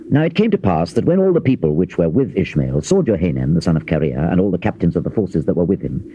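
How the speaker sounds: usual speaking voice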